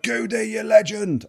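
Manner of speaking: slow, high mock shout from down the street; imitating a fan's shout. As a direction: call out his name like a fan across the road. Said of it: "he shouted out".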